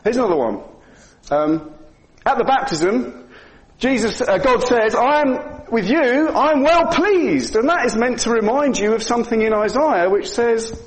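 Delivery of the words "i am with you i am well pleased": voice of God. Acted. Preacher voicing God at baptism.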